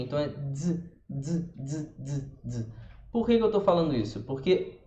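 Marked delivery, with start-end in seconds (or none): 0.0-2.7 "dz" as a very short d with a buzz; short dz sound. Shown as a demonstration. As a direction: tap out "dz, dz" softly. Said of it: "Imagina que é um D Z bem curtinho". ("dz" bem curtinho)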